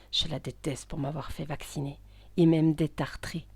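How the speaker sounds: low, spiteful cat's voice; hateful, gloating cat. Acted. Cat resenting his owner after her fall, spiteful.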